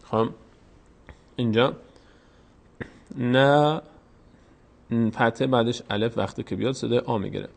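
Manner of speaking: ordinary delivery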